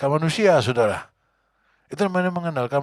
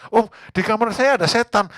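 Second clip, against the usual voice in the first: panicked caller; 10 semitones higher, 7 dB louder than usual. Retold or imitated. Frightened man, pastor retorts.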